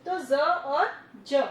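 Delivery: slow contrast of ज and ज, the second with the teeth together; contrast of two sounds. Shown as a demonstration. Teacher slowly contrasting ज and ज for students. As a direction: say ज then ज slowly, teeth touching. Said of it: "और ज में दोनों teeth आपस में टच".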